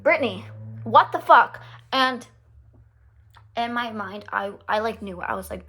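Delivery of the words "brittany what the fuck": loud, exasperated reproach; raised-voice indignation; exasperated outburst. Real, not acted. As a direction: call Brittany out in disbelief. Exclaims at what Brittany did.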